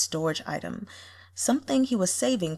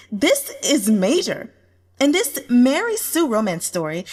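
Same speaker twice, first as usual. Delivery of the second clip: higher and louder, urgent hype; urgent fan comment; 6 semitones higher, 6 dB louder than usual. Acted. A commenter urging others to read, urgent excitement. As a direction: hype the news to other readers.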